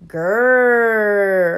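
loud, long drawn-out growl; dog growl. A narrator's reading. Narrator imitating the neighbor dog's growl, long and loud. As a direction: growl it, holding the r. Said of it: "Mister Barker's growl".

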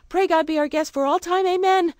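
put-on fervent little girl's grace. A narrator's reading. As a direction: say grace eagerly like a child. Two Eyes says grace.

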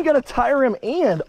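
normal talking voice

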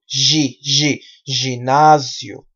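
"gi, gi, ginasio" with buzzing zh, deliberate; Portuguese pronunciation. Shown as a demonstration. Teacher drills the Portuguese syllable, slow Portuguese pronunciation. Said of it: "Decimos en portugués ginasio".

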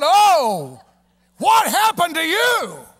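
high, loud, slow shout of shock; doctor can't believe his eyes; loud, drawn-out amazed cry; astonished doctor. Retold or imitated. Astonished doctor sees him.

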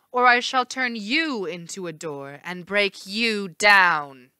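cold regal threat. Acted. Hera threatening coldly.

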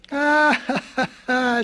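put-on high chuckling old goat; laughing. A narrator's reading. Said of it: "said the old goat".

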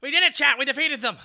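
loud high triumphant shout; victory cheer. Real, not acted. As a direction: cheer the win.

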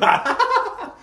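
high burst of laughter. Real, not acted. Amused reaction to the episode.

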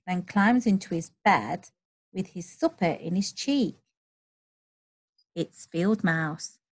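ordinary delivery

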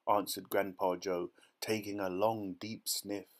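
usual speaking voice

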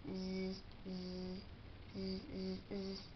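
soft, low sleepy snores; snoring sounds. A narrator's reading. Reading the sleeping dinosaurs, soft sleepy snoring. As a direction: drift off with quiet zzz. Dinosaurs fall asleep in story.

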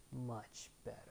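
pleased, nearly under the breath; Raditz smug. Retold or imitated. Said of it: "And Raditz like".

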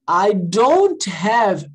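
very slow, clear I don't have; teacher modelling a phrase very slowly; very slow model. Shown as a demonstration. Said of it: "Ó".

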